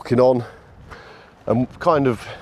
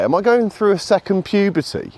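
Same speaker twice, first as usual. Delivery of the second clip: usual speaking voice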